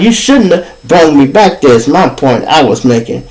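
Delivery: normal talking voice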